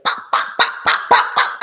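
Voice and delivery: unvoiced pop pop bursts; popping gunfire sounds; gunshot noises. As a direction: mimic gunshots with the lips. Imitating gunshots.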